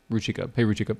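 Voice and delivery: normal talking voice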